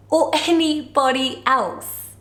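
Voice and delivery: slow, drawn-out snooty aside; a slow diva sneer. Acted. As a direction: deliver it like a haughty pop star.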